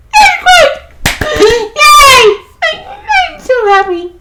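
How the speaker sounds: very high squealing joy; overjoyed squeal. Real, not acted. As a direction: squeal with real delight. Gushing over story romance.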